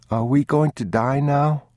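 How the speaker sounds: put-on high child's question; anxious little boy. A narrator's reading. Boy asking father whether they will die, high anxious question. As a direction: ask fearfully in a child's pitch.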